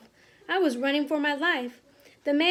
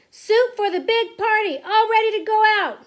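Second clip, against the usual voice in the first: loud, high kitchen call; shouting; 7 semitones higher, 9 dB louder than usual. A narrator's reading. Cook announcing the soup is ready, shouting. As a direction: shout it out across a busy kitchen. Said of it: "He put the lid on the basin and shouted".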